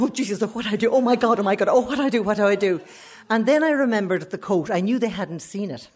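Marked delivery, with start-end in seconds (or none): 0.0-2.8 higher, mild breathless-sounding fluster; retold panic. Standing in the car park, mildly panicking. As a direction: run the panicky questions together, pitched up. Acting out her panic in retelling.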